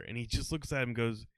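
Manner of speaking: usual speaking voice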